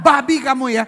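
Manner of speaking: loud, high hammed-up insult; mock angry. Comic imitating an angry person's curse, loud mock fury. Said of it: "kalau marah nggak pernah bilang".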